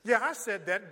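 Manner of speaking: ordinary delivery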